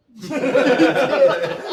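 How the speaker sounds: loud, high cackling laugh; burst of laughter. Real, not acted. Laughing at friend's retold last words before a shot, loud burst. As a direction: crack up hard and loud.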